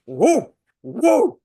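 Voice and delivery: high loud mock bark; dog barking imitation. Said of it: "barking like Dolly did".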